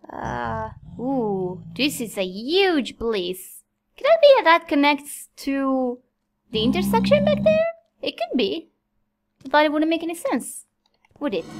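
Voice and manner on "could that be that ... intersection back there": ordinary talk, nothing marked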